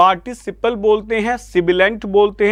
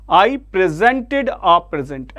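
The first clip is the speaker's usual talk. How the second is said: slow "presented" with ZENT stressed, "present" with PREZ stressed; contrasting word stress; much slower than usual. Shown as a demonstration. Teacher repeating example for students, "presented" late stress, "present" early stress. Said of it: "ऐसे बोला जाएगा बेटा".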